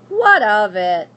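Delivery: loud, slow, dismissive; curt witch. Acted. Witch dismissing the lost princess, loud and curt. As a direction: brush her off.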